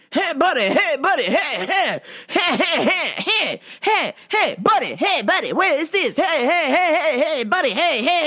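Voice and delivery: high, loud nagging hey buddy; pushy customer. Retold or imitated. Customer badgering a busy worker, high and loud. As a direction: pester like an impatient customer.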